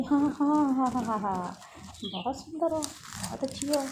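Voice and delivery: acted scornful flattery; sarcastic agreement. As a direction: humour them with exaggerated agreement. Mocking the other's claimed beauty.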